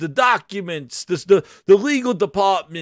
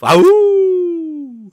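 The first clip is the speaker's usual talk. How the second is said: wolf howl; 12 semitones higher, 8 dB louder than usual. Pretend.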